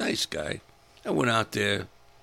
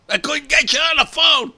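much louder, higher yelled retort; full shout; 13 dB louder than usual. Pretend. Husband shouting back at wife who scolds him, full shout. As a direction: yell it back at her.

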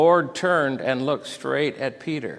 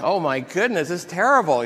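raised, loud exclamation of dismay; retold horrified outburst; mock dismay; 6 semitones higher, 7 dB louder than usual. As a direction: exclaim as if appalled at yourself. Retelling own dismayed reaction.

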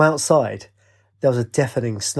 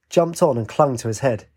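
plain reading voice

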